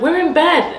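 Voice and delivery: high, loud shout back down the stairs; calling back. Retold or imitated. Boys calling back down to grandma, high loud shout. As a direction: kids yelling they are already in bed.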